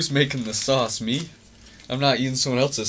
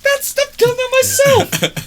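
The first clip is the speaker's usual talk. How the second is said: high-pitched outburst; 6 dB louder, slower than usual. Real, not acted. Indignant comeback to teasing co-hosts, really high-pitched. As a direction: voice leaps way up, loud. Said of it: "you got really high pitched".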